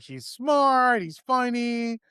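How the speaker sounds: a hint of a proud mother bragging; gushing mom. Mom bragging about her son, lightly gushing. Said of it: "she's like".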